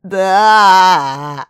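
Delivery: forced out with effort; strained. Acted. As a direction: squeeze the word out painfully. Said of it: "даже такое коротенькое слово получилось с трудом".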